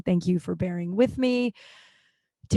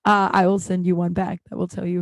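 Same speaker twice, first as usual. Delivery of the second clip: ordinary talk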